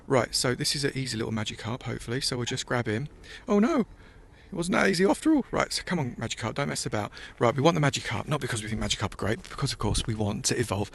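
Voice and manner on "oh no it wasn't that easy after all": surprised. Real, not acted. Fish escapes ball.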